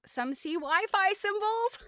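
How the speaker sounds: amused as she reads it; giggling through the words; laughing read. Real, not acted. Reading statement, laughs at Wi-Fi.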